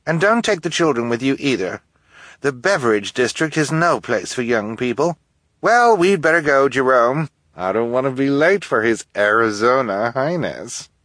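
high, loud, hurried lady; impatient socialite. Acted. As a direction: rush him out the door. Esme hurrying off.

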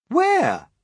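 high, loud eager cry; surprised. Acted. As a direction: blurt it out in surprise. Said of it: "they said".

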